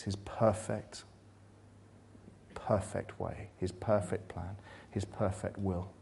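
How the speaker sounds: very soft and slow, reverent; hushed reverence. Acted. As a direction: linger softly on each perfect. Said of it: "softly savouring repetition".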